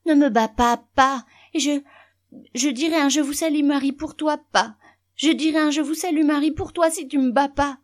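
put-on high, loud child's begging; pleading in fear. A narrator's reading. Boy begging father not to beat him, high frightened pleading. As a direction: implore desperately as a terrified little boy. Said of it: "supplia-t-il".

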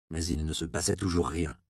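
ordinary delivery, nothing marked

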